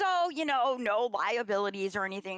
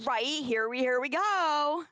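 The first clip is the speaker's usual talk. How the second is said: here we go drawn out, higher and louder; sing-song; 5 semitones higher, 3 dB louder than usual. Pretend. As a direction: stretch "here we go" slowly upward like a chant. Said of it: "That actually rhymed".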